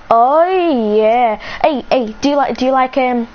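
loud, eager hello to a commenter; loud hyped reply to a comment; excited chatter. Real, not acted. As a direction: rattle off excited greetings. Loudly greeting a commenter.

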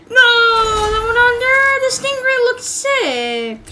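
high, loud, drawn-out wail; anguished protest. Real, not acted. Gamer losing out on the Stingray, wailed protest. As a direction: wail it in disappointment. Upset over a lost vehicle.

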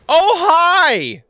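high, loud yelp of greeting; startled greeting. Real, not acted. Player greeting a boss that just appeared, startled. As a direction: blurt it out high, caught off guard.

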